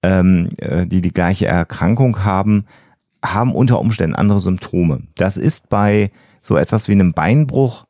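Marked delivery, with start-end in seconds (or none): none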